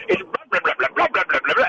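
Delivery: rapid gibberish blahs, pitch jumping up and down; mock crosstalk babble. Panelist mocking debate candidates nobody can understand, garbled babble.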